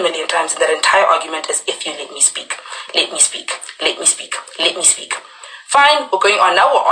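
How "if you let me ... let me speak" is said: soft, hushed repeated plea; subdued retold repetition. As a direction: drop the volume and repeat it like a refrain. Repeats her line from the argument.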